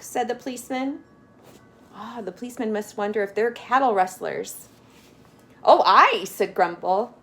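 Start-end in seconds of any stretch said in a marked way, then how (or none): 5.6-6.2 hearty agreement. Acted. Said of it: "truthful once more".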